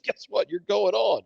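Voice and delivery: normal talking voice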